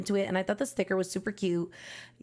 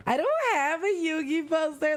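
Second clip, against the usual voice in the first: excited gasp; 6 semitones higher than usual. Real, not acted. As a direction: gasp then a higher, thrilled line. Realises she lacks this poster.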